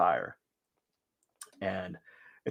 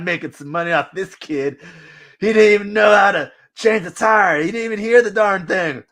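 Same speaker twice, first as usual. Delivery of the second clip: high, louder gossip voice; a hint of a smug mechanic; mocking mechanic; 7 semitones higher, 6 dB louder than usual. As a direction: shop worker gloating in the lunchroom about the clueless kid. Auto shop staff gossiping about kid.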